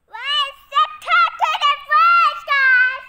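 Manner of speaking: loud, high, slow call. Real, not acted. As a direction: call out across the room. Child calls out loudly.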